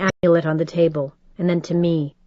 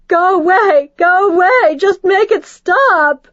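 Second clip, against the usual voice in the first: very high, loud screaming; hysterical screaming; 12 semitones higher, 7 dB louder than usual. Acted. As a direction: scream him away, flailing. Mother hysterical, swinging blindly.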